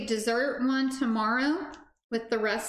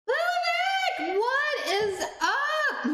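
excited greeting; 14 semitones higher, much slower than usual. Real, not acted. Greeting arriving viewers on stream.

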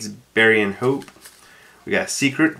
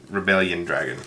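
normal talking voice

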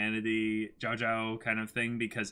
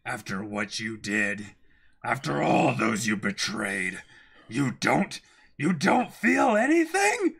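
building rage; 2 semitones lower, 4 dB louder than usual. Acted. Voicing Guts confronting Griffith, building to a furious yell. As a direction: let the anger swell into a shout. Reading Guts's angry line.

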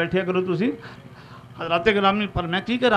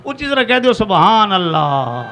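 loud congregational prompt, stretched at the end; loud rallying call; 7 dB louder than usual. Real, not acted. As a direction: urge the crowd to answer louder. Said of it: "ਤੁਸੀਂ ਨਾ ਉੱਚੀ ਕਿਹਾ".

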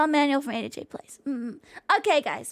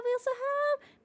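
a playful higher, quieter repeat; sing-song call much higher up; high soft sing-song; 10 semitones higher, 7 dB quieter than usual. Shown as a demonstration. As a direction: lift it high and soft, half sung. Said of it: "repeats the line up high".

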